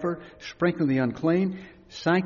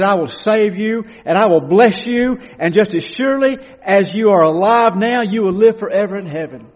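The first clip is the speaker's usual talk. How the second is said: loud, raised assurance; ringing promise; 5 semitones higher, 9 dB louder than usual. Acted. Preacher voicing God promising salvation, loud ringing assurance. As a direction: proclaim it as a sure promise.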